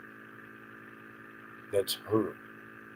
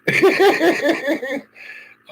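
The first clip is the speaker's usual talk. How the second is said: a high, loud burst of stunned laughter; shrieking disbelieving laugh; high helpless laugh; 11 dB louder than usual. Real, not acted. Stunned laughter after news.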